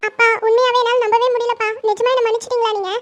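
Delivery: high, tearful questioning; tearful disbelief. Acted. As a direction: ask father through lingering tears. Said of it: "அப்புறம் ஏன் அழற?".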